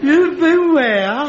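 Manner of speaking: high, drawn-out disbelief; incredulous. Acted. Son hearing of dad's spiritualist meeting, incredulous. Shocked at father's news.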